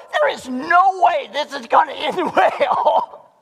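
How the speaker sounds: high, slow, laughing disbelief; laughing doubt. Retold or imitated. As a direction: laugh it off as hopeless. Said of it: "can go".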